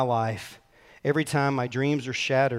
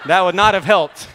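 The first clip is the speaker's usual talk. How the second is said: much louder and higher, laughing through it; 5 semitones higher, 11 dB louder than usual. Real, not acted. Preacher laughing at his brother's storm memory, loud laugh. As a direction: burst out laughing at the thought.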